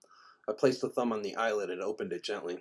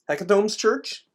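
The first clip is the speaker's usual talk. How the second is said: put-on surprised boy; 11 semitones higher, 9 dB louder than usual.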